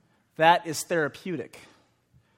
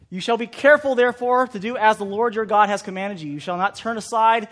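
raised solemn scripture read; 4 semitones higher, 6 dB louder than usual. Acted. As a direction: proclaim the verse. Reads Deuteronomy aloud.

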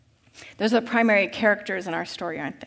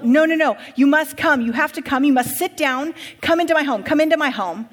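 higher, louder, insistent rich master; urgent master; 5 semitones higher, 6 dB louder than usual. Acted. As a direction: hurry him inside, pleading and pressing.

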